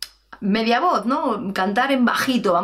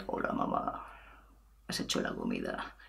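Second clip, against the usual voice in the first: creaky, very low and soft; vocal fry; 16 dB quieter than usual. Shown as a demonstration. Child asking mother about lunch in vocal fry. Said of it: "Intentad hablar con este sonido".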